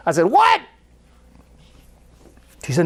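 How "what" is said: high incredulous yelp; shocked what. Retold or imitated. Pastor stunned by the waitress's news. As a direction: jump up in disbelief.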